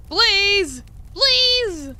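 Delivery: high, loud pleading cry; desperate scream. Acted. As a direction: beg with everything left. Despairing plea in fire.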